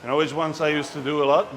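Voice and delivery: ordinary talk, nothing marked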